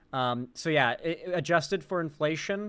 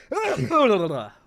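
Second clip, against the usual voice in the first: loud sudden sneeze-cough burst; real sneeze breaking out; sneezing; 7 semitones higher, 8 dB louder than usual. Real, not acted. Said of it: "I have to sneeze so bad".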